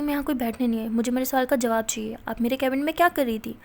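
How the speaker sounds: usual speaking voice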